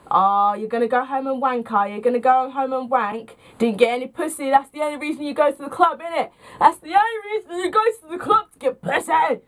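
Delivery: relentless taunts rising in pitch; imitated drunken needling; tipsy friend jeering. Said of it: "so much emphasis on the word pussy".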